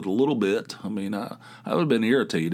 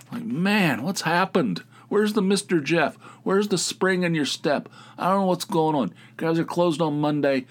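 mock exasperation; 8 semitones higher than usual. Exasperated about the clerk.

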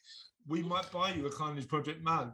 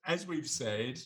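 ordinary delivery

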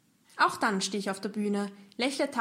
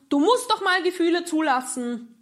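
much higher and louder, hammed-up lecturing; mock preachy; 7 semitones higher, 8 dB louder than usual. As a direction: parody the well-meant advice. Quoting a common motto. (gespielt belehrend, höher und lauter)

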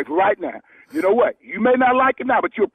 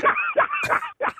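laughing; 4 dB quieter than usual. Real, not acted. Victim laughing at prank.

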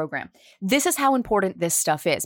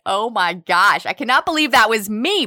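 amused disbelief; 2 semitones higher, 6 dB louder than usual. Retold or imitated. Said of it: "I'm like".

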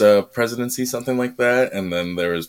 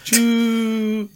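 train sound. Pretend. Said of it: "Choo, choo".

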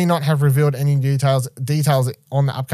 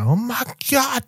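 amazed fan; 11 semitones higher, slower than usual. Retold or imitated. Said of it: "little Dylan's over here going".

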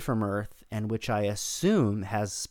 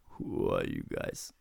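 deep, gravelly and much lower; gravelly Thor voice; 4 dB quieter than usual. Retold or imitated. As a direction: growl it out low and rough. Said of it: "in a very gravelly voice".

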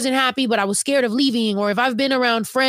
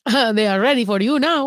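put-on Mexican accent. Shown as a demonstration. Host joining the accent game, Mexican accent like Nacho Libre.

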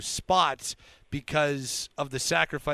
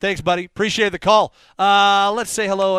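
loud, high, elated host; on cloud nine; 6 semitones higher, 11 dB louder than usual. Real, not acted. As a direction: ride the high of a win. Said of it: "now I'm on cloud nine".

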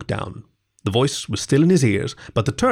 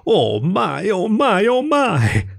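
high, awestruck cry climbing with each repeat; gasped wonder; 9 semitones higher, much slower than usual. A narrator's reading. Mole overcome by the beautiful river, gasping in awe. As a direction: gasp it out, stretched and rising. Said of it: "and gasp".

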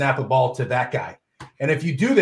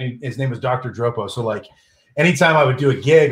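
usual speaking voice

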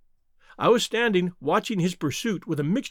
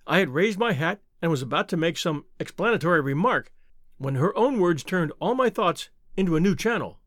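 ordinary delivery, nothing marked

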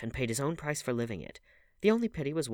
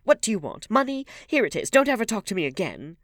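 loud, high-pitched fury; exasperated outburst at full voice; angry cry; 13 semitones higher, 12 dB louder than usual. A narrator's reading. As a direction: throw the words at her angrily. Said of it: "cried Dorian, stamping his foot".

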